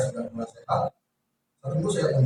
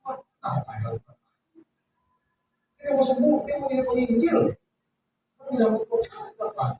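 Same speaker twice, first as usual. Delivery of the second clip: rising, pleading protest, much higher; protesting to God; 10 semitones higher than usual. Retold or imitated. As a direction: complain to God, pitch climbing toward the end. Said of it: "Saya protes sama Tuhan". (protes kepada Tuhan dengan nada tinggi)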